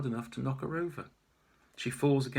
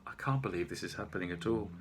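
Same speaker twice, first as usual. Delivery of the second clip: ordinary delivery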